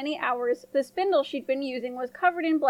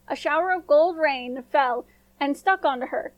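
usual speaking voice

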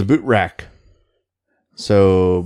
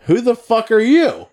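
incredulous shout back; 13 semitones higher, 3 dB louder than usual. Retold or imitated. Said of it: "we're all like".

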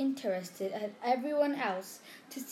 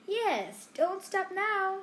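mocking; 9 semitones higher, slower than usual. A narrator's reading. Ron mocks Hermione.

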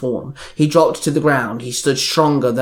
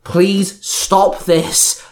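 slow, higher-pitched plea; calling out; 5 semitones higher, much slower than usual. A narrator's reading. Violet begging the sister to stop, calling out. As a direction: plead aloud, drawing the words out. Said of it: "Violet called out".

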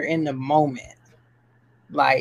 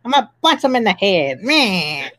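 fast gangster voice, higher pitch; James Cagney impression; 4 semitones higher, faster than usual. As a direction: tough guy bragging, see. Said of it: "That's our impression of James Cagney".

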